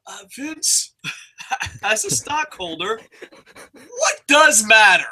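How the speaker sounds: sneering at the boss's excuses; sarcastic rant. Acted. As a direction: drag out each line with sarcasm. Sarcastic mock of company line.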